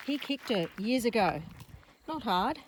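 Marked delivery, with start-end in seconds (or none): none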